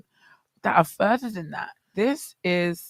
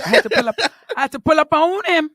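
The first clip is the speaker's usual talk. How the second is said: rising, louder indignation; fired up, building to a higher, louder peak; indignant; 9 semitones higher, 6 dB louder than usual. Real, not acted. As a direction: pull up on him, heated. Indignant retelling of tailor.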